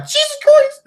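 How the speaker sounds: very high, loud panicked yelp; scared yelp. Retold or imitated. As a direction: yelp it as the dogs charge. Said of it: "I'm like".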